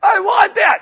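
loud, high mock tantrum; hammed-up bratty child voice; whining child. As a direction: demand it like a spoiled kid. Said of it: "you when you're growing up with your mom".

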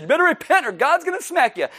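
mock stern prophet, pitched high; lightly mocking a harsh prophet; mock prophet warning. Prophet's blunt warning mocked.

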